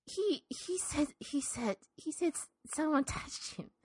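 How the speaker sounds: barely able to say it; hushed and hesitant. Acted. Old woman telling the reverend, hushed and halting.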